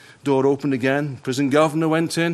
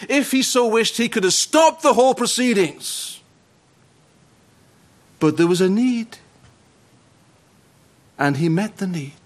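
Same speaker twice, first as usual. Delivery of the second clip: rising, ringing pulpit cadence; fervent preaching; 5 semitones higher than usual. Acted. Preacher to his congregation, fervent pulpit preaching. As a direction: preach it, building to a peak.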